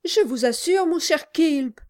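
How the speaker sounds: high, frightened wife; trembling. A narrator's reading. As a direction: plead nervously, voice trembling. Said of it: "répliqua sa femme en tremblant".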